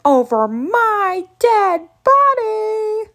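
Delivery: slow, high, loud refusal; loud, stretched-out defiance; defiant outburst. Acted. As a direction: draw out every word in a rising yell. Sudden refusal to give dog back.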